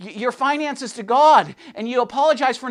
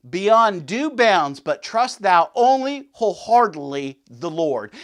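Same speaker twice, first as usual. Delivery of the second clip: solemn reading; 5 semitones lower than usual. Acted. Reads Spurgeon quote solemnly.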